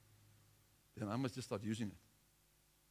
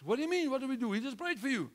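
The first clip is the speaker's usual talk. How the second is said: bewildered reply; 12 semitones higher, 4 dB louder, faster than usual. Retold or imitated. Couple answers the screaming man.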